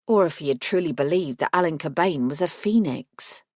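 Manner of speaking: plain reading voice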